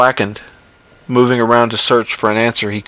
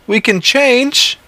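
much higher, slow, desperate plea; John pleading; 14 semitones higher, slower than usual. Acted. John pleads with the creature, high and slow. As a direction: beg slowly for another chance. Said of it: "he asked".

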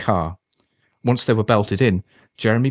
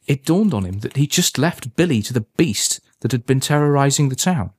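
usual speaking voice

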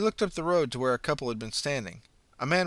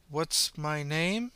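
drawn-out, wondering repeat; slow, rising echo of the question; slow puzzled question; 4 semitones higher, much slower than usual. Acted. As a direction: stretch it out as if unsure. Puzzled girl repeats slowly.

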